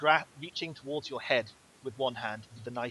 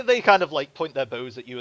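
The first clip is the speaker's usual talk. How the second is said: ordinary delivery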